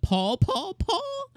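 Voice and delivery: rising calls ending in a high, cracking cry; name cried out in shock. Real, not acted. Shocked by father's reveal.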